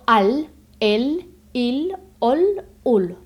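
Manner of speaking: "al, el, il, ol, ul" as a careful listing demo; inverse syllables, one by one. Shown as a demonstration. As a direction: sound out "al, el, il, ol, ul" one syllable at a time. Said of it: "sílaba inversa".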